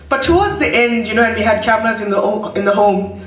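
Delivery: normal talking voice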